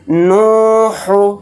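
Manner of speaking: نو drawn out long, ہو short and quick; slow نو, fast ہو; stretch the first syllable. Shown as a demonstration. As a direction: hold نو for one alif length then clip ہو. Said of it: "نون کو تو ہم نے کھینچ دیا".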